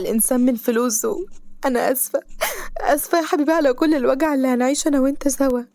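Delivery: a grieving mother weeping to her unborn son; tearful grief. Acted. Mother weeping over her baby's fate.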